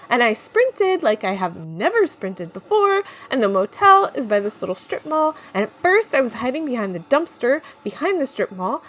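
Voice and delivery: ordinary talk, nothing marked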